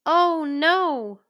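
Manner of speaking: alarmed cry. Acted. Cinderella panics at midnight.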